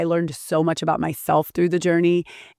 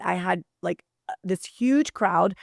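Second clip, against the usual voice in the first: ordinary talk, nothing marked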